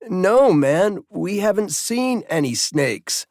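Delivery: put-on high, slow, overly careful teen boy; a high young voice spelling out every word; stoned teen enunciating. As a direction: talk slowly so nobody hears you're stoned. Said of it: "enunciating slowly, taking pains not to sound stoned".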